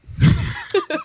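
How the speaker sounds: unvoiced burst of laughter; breathy high laugh. Real, not acted. Laughs at super hoe.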